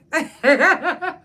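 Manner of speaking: short high laugh; quick burst of laughter. Real, not acted. Laughs about hospital friends.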